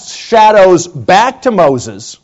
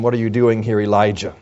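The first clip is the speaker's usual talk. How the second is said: quiet neutral asking; 8 semitones lower, 6 dB quieter, faster than usual. Shown as a demonstration. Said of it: "What is the intent of the question".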